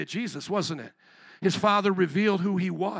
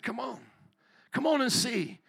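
much softer, hushed and inviting; tender invitation; 7 dB quieter than usual. Retold or imitated. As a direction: welcome them in gently, almost murmuring. Jesus inviting the two men.

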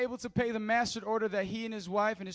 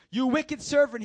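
loud, high accusation from a master; angry rebuke; 5 semitones higher, 8 dB louder than usual. A narrator's reading. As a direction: denounce him with a raised voice. Master rebukes servant, read aloud.

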